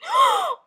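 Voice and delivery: high, theatrical gasp; shocked gasp. Acted. Child hears the babysitter's order to beg, shocked gasp.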